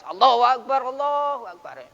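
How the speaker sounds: loud, firm call to prayer. Shown as a demonstration. Teacher demonstrating a firm, loud adhan. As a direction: call it out strongly and firmly. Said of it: "Suara adanya tegas".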